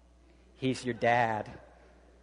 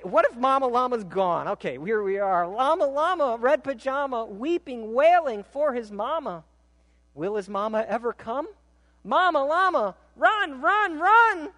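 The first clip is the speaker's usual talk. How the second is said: much higher, louder cry for mama; high frantic reading; 11 semitones higher, 4 dB louder than usual. Acted. Baby llama crying for mama, high frantic shout. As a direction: wail it out, high and urgent.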